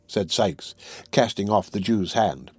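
usual speaking voice

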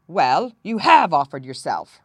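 loud, emphatic comeback; sharp retort. A narrator's reading. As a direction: throw it back at him forcefully. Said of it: "retorted the king".

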